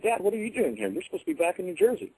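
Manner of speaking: high, startled question to his father; a boy blurting out in shock at seeing dad; surprised. Retold or imitated. Ten-year-old startled to find his dad at Gettysburg, surprised high voice.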